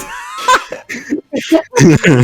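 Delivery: high shrieking laugh breaking out; burst of laughter. Real, not acted. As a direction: lose it laughing at the line. Reading absurd fanfic aloud.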